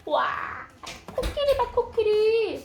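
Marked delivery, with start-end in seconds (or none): 1.1-2.6 excited pointing. Acted. Showing baby picture book.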